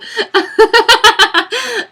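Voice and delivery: high, helpless burst of laughter; loud laughing fit. Real, not acted. Laughing at her own failed accent, helpless cackle. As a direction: crack up loudly at yourself.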